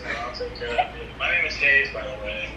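ordinary delivery

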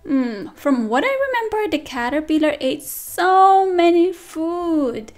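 ordinary delivery

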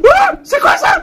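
high, loud startled cry; startled shriek. Real, not acted. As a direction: yelp at the surprise. Sudden surprise in game.

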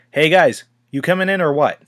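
impatient call over the comlink; calling over radio. A narrator's reading. Tony calling the others over the comlink, impatient.

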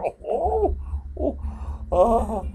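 high startled ohs. Acted. More things go wrong.

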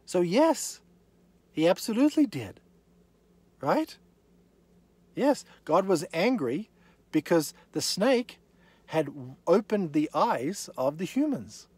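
full-force preaching. Real, not acted. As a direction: drive the point home hard.

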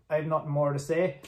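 ordinary delivery

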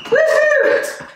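excited woohoo; woohoo cheer. Real, not acted. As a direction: whoop to start the set. Cheering on the class.

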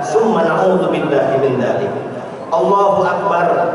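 normal talking voice

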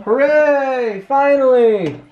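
high, loud cheer; excited cheer. Real, not acted. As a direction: cheer in relief. Mask finally off.

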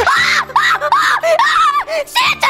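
high-pitched squeals for Santa; put-on screaming fit over Santa; excited shrieking. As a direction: shriek like an overexcited little kid. Excited child calling for Santa.

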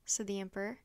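plain reading voice

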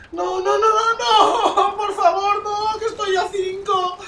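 high-pitched tearful "no"; wailing despair. Real, not acted. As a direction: wail as the prize slips away. Match ends five from nuke.

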